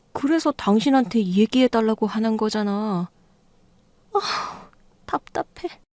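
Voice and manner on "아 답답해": 답답해서 숨 섞어 내뱉듯, 높고 작게; 말 안 통하는 남편에게 답답해서 내뱉는 아내; exasperated. Acted. Wife fed up with husband. (답답한 한숨 섞인 말)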